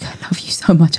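put-on soft tender whisper over a sleeping child; hushed whisper of love to the sleeping son. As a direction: whisper it gently so he won't wake.

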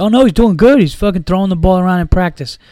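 high, loud sarcastic parroting of the team; mocking quote. Mocking team injury reports.

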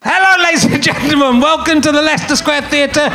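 grand showbiz introduction building to a shout; announcer voice. Acted. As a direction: announce the star like a theatre MC.

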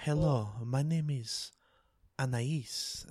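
slow, soft French imitation; carefully badly faked French accent; attempted French accent. Taking a turn at the vampire, soft French accent. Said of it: "French is hard".

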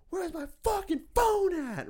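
imitated high, drawn-out frantic yell; freaking out. Drugged neighbor hunting his phone, mock freak-out. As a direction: yell it slow, loud and very high. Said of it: "He's freaking out".

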